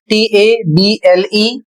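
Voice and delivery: slow letter-by-letter spelling of टीएबीएलई. Shown as a demonstration. Teacher spells the word table for students, slowly. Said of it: "अगला".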